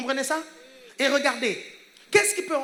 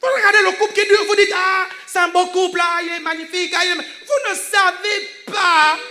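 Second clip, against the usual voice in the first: loud, raised preacher's voice; full-force preaching; 4 semitones higher, 7 dB louder than usual. Real, not acted. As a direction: drive it home to the whole congregation. (prédication à pleine voix)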